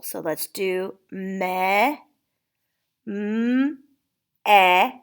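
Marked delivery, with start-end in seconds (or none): 1.1-2.0 me as a drawn-out "meh"; isolated syllable. Shown as a demonstration. Teacher tapping first syllable of meadow, me held as "meh". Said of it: "we're gonna tap the syllable". 3.1-4.9 m held as a hum, then a separate e as "eh"; tapped sound by sound. Shown as a demonstration. Teacher tapping meadow's syllable, m and e as separate sounds. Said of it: "we're gonna tap the syllable".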